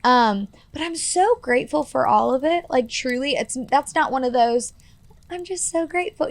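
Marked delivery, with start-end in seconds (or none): 5.3-6.3 higher, softer sarcastic parody of hollow gratitude; mock fake gratitude. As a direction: mimic a robotic, insincere "so grateful", pitched up and softer. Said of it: "robot who can't actually process her pain".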